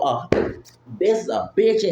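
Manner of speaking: ordinary talk, nothing marked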